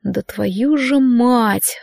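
slow, tight curse pushed through clenched teeth. A narrator's reading. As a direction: grind it out between your teeth. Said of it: "сквозь зубы проговорил я".

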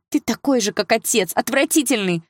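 high-pitched, disgusted accusation; shrieked reproach; crying out. A narrator's reading. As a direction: fling it out in a high, upset voice. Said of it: "выкрикнула Саша".

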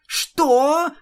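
high, loud shocked what; shocked cry. Acted. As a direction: blurt it out in shock. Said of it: "хором крикнули Пинки и Твайлайт". (потрясённый выкрик)

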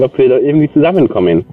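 ordinary delivery

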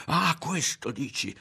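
high, angry screamed challenge; mother's furious, high-pitched yell. A narrator's reading.

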